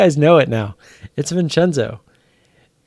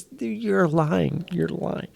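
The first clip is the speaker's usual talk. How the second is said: higher, slow, incredulous; higher, slow, can't-believe-it; disbelieving; 5 semitones higher, 5 dB quieter, much slower than usual. Real, not acted. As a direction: drag out the accusation in disbelief.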